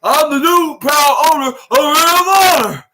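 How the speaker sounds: high, loud shouted announcement; hammed-up Vince McMahon proclamation; mock Vince McMahon. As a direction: bellow it like a wrestling boss. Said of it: "his version of Vince McMahon saying".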